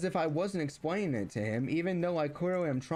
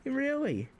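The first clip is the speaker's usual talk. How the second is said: sarcastic, much higher really; mock disbelief; 10 semitones higher than usual. Reacting to father's news about brother, sarcastic disbelief. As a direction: pitch it up in fake surprise. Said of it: "I'm just like".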